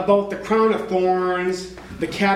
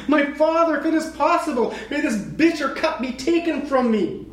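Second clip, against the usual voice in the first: higher-pitched earnest plea; praying; 7 semitones higher than usual. Acted. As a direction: plead upward to a father. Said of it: "he prayed".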